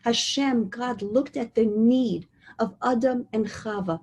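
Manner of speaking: normal talking voice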